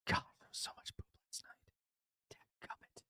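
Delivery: soft unvoiced whisper. Real, not acted. Tired dad confiding about last night's diapers, whispered. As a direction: breathe it out under your breath. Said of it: "whisper about night's diapers".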